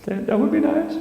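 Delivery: high sweet wife voice; coy wife. Retold or imitated. Wife accepting the chai peace offering, high and coy. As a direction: make it coy and demure. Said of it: "She goes".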